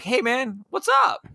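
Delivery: high-pitched, casually pointed greeting; passive-aggressive. Acted. Beachgoer greets the people who moved his stuff, passive-aggressive. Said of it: "in passive aggressively be like".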